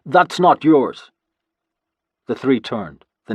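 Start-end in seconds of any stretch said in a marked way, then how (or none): 0.0-1.1 slow, loud, high shout. A narrator's reading. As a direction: bellow it in outrage. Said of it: "I shouted in Norse".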